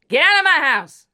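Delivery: yelling mother. Acted. Drunk mother screaming Dave out of the house. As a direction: throw him out with a screech. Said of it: "She then yells at him".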